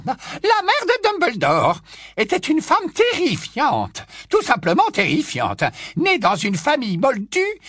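put-on high, loud old busybody voice; high loud tipsy old aunt gushing; old aunt Muriel.

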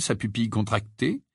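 ordinary delivery

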